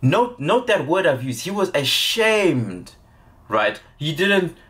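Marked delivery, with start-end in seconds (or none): none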